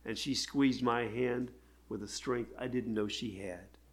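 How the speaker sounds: normal talking voice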